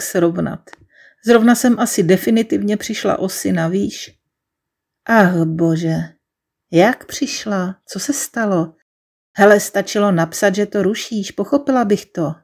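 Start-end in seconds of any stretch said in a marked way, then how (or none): none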